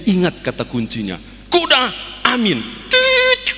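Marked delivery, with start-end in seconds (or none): 1.5-2.6 high urgent call to halt the horse; acted urgent command. Pastor shouts the stop word. 2.9-3.5 very high, loud squeal of brakes; imitated screeching halt.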